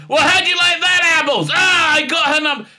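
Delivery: high, loud triumphant taunt; imitated movie gloating; gloating shout. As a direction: crow it in a rival's face. Acted triumphant film taunt.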